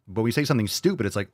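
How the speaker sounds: ordinary talk, nothing marked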